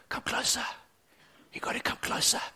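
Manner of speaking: unvoiced hush; soft whispered invitation; gentle whisper. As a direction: whisper it like God close to the ear. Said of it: "gentle whisper".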